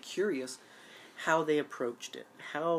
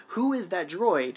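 slow, higher and louder; excited; 5 semitones higher, 7 dB louder, slower than usual. Real, not acted. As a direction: lean hard on the question. Excited demand.

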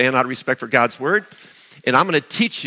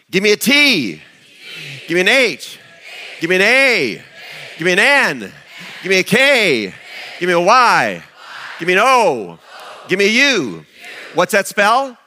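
loud, higher cheer chant; cheerleader; 6 semitones higher, 4 dB louder than usual. Shown as a demonstration. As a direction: lead the crowd in a cheer. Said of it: "actions included".

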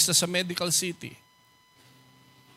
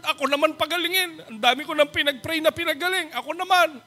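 high, pleading plea to God; a man begging God to heal him, pleading; pleading prayer; 8 semitones higher than usual. Retold or imitated. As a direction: beg the Lord for healing.

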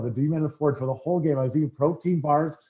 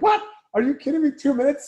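much higher, disbelieving protest; indignant disbelief; 11 semitones higher, 4 dB louder than usual. Retold or imitated. Rookie protests the ref's call, high and indignant. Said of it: "I'm like".

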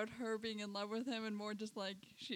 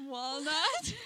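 bad southern accent, laughing; clumsy Southern twang with a laugh; 4 semitones higher, 6 dB louder than usual. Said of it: "I cannot do a southern accent".

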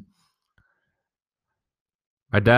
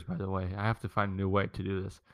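usual speaking voice

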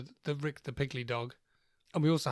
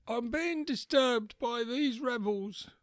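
put-on high, quavering old man voice; lightly voiced grandpa, confused and cranky; doddery old grandad; 6 semitones higher, slower than usual. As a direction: play a confused old war veteran.

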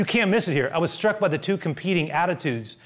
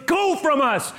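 high urgent demand; 12 semitones higher, slower than usual. Acted. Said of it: "One begs him".

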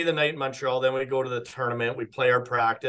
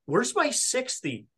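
high, slow, anxious asking; retold panic; 8 semitones higher than usual. Searching the golf bag before tee-off: high slow worried question. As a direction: imitate the rising alarm of a missing club. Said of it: "I'm just panicking".

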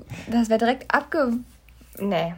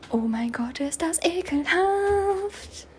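angeekelt; 7 semitones higher, much slower than usual. Real, not acted. Ekel über den Käfer.

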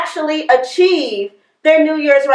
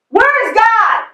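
higher, pushed mock booming question; hammed-up stern pastor, pitched up; sterner pastor; 7 semitones higher, slower than usual. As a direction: play the huge pastor demanding an answer. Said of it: "he raised his voice a little bit more".

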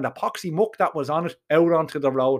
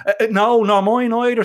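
higher, louder firm no; insistent, raised-pitch refusal; emphatic refusal; 6 semitones higher, 5 dB louder than usual. Acted. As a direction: refuse it and mean it. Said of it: "says I, and I meant it".

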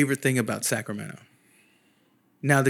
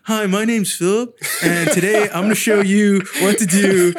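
high, loud tutorial-host intro; raised, projected how-to video voice; mock YouTube host; 6 semitones higher, 6 dB louder than usual. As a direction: put on an over-cheery video presenter.

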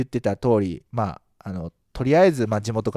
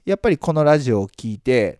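ordinary delivery

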